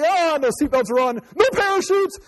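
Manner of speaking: hammed-up high pilot voice; mock pilot. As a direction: squeak it out like a panicking pilot. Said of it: "it's like".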